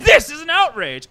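high, loud indignant cry; outraged. Acted. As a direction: burst out in theatrical outrage. Mojo outraged at defiance.

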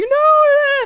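high, loud wailed protest; mock crying mom. Mom crying over her kid's date, hammed-up wail. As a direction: imitate a mother wailing in tears. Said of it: "my mom was crying".

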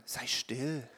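soft, low mock shush; a hint of a crowd telling him to hush. Everybody telling the blind man, softly, to be quiet. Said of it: "Und alle anderen sagten".

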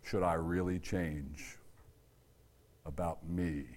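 slow, hushed self-question; low and slow, letting it sink in; soft reflective question. Shown as a demonstration. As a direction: ask it quietly, inward. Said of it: "good question to ask yourself".

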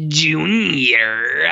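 imitating a strangely lifted, drawn-out "Junior."; odd delivery of Junior. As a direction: mimic the actor's weird way of saying "Junior.". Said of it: "And he says it like that".

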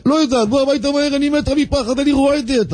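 panicked wife begging her husband to hurry home. Retold or imitated. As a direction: squeak it out like a terrified wife.

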